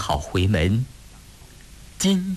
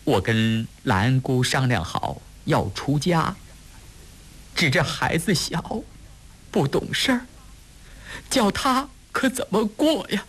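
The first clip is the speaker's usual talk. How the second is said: tearful plea; 5 semitones higher than usual. A narrator's reading. Woman begs cousin for the child's sake, close to sobbing. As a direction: voice climbing higher toward tears. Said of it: "呜呜咽咽地放出了声".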